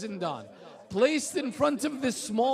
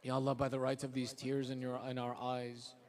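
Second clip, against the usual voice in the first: low, soft supplication; preacher praying softly for the mourners; quiet prayer; 9 semitones lower, 10 dB quieter, faster than usual. Acted. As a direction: murmur the dua humbly. Closing supplication after the masa'ib.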